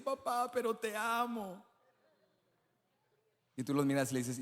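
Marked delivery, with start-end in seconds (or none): 0.0-1.6 put-on higher, softer pleading child voice; crying child. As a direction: voice a little kid begging dad. Child pleading in father story.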